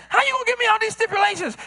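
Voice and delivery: imitating a mad friend; mock angry friend. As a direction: play the offended friend. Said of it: "the friend gets mad".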